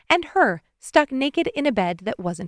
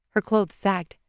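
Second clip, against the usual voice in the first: usual speaking voice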